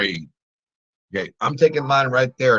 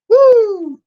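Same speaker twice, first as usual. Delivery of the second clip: high, loud victory whoop; celebratory whoop; 8 dB louder than usual. Real, not acted. Trader whooping at a winning trade, high and loud. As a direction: let out a joyous whoop.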